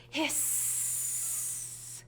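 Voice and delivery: soft breathy snake hiss. Pretend. Librarian playing a snake for children, soft hiss. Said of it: "what do they say".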